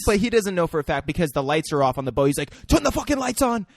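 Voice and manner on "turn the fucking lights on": high, raised-voice order; mock-angry high command; acted demand. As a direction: bark the order as the pirate.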